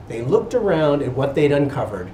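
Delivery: ordinary delivery, nothing marked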